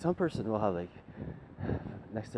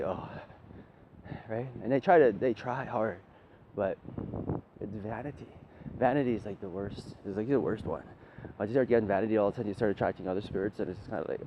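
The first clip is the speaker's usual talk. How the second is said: normal talking voice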